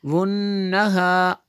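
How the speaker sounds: वुन्नहा with the nn nasal held long; held ghunna. Shown as a demonstration. Teacher to students, drawing out the ghunna. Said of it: "जितना गुन्ना करना है उतना".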